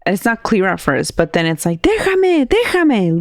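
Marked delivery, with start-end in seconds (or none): 1.8-3.2 high mock yell of leave me; high pleading mock cry; retold yelling. Imitating the demon voice yelling on the call, high mock yell. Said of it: "someone yelling in the background".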